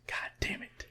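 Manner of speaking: breathy whispered goddamn; whispered curse. Real, not acted. Listener reacting to the director story, whispered curse. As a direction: mutter it under your breath.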